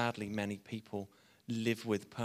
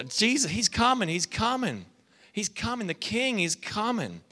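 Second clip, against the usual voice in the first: high, loud proclamation; heralding announcer; 8 semitones higher, 5 dB louder than usual. Retold or imitated. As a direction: herald a king's arrival with excitement. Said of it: "He was like the announcer".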